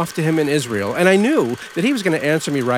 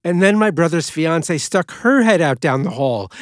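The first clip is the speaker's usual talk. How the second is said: ordinary talk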